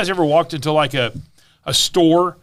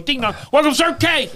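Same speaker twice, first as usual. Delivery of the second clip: higher sing-song clerk call; light mock clerk shout; mock store greeting; 9 semitones higher than usual. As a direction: imitate the door chime then the greeting. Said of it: "they just scream out".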